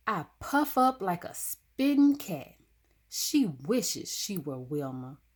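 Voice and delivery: ordinary delivery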